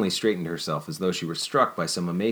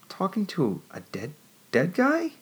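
slow, then high incredulous squeak; stunned disbelief; 11 semitones higher, slower than usual. A narrator's reading. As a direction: struggle to believe it. She struggles to accept.

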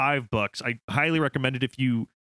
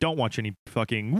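ordinary talk

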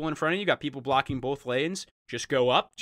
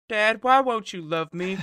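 whiny son voice; 5 semitones higher than usual. Acted. Son voiced pleading to dad.

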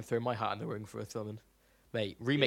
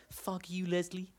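high, softer Australian comeback; mock Australian cartoon voice, pitched up; Australian accent imitation; 6 semitones higher, 7 dB quieter, slower than usual. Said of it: "an imitation of this one YouTube".

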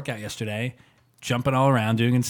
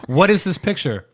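loud, raised-pitch mock alarm; all-caps outburst; 4 semitones higher, 6 dB louder than usual. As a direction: imitate a scandalized text in capitals. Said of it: "he wrote in all caps".

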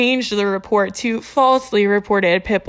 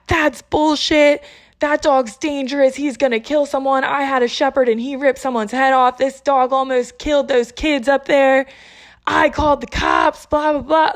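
high, mildly raised mock yelling; mock screaming rant; 4 semitones higher than usual. Angry man telling police the dog is dangerous, mock screaming. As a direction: imitate an outraged man ranting, without full volume. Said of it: "starts screaming".

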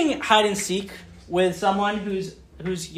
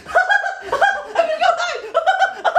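put-on much higher, slow giggling child; giggly little girl; much slower than usual. Little Eden running off to hide, much higher giggling. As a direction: giggle through it like a delighted toddler. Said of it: "giggly little Eden".

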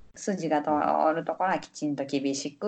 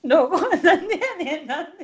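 laughing; 10 semitones higher, 5 dB louder than usual. Real, not acted. Laughing retort at old-age joke.

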